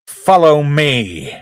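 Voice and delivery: slow, drawn-out order; acted command. Tackleberry to the guy, slow drawn-out order. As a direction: stretch it out like a movie line. Acting out Tackleberry's scripted line.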